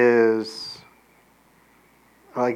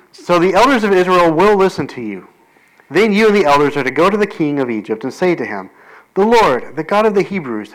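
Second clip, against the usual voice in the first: louder, proclaiming scripture reading; 3 semitones higher, 4 dB louder than usual. Acted. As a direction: proclaim the passage aloud. Resumes reading God's words.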